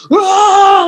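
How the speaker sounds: loud, high frustrated growl; a toddler-fit yell at home, mock bear roar. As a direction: roar like an angry bear stomping around. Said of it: "like a bear".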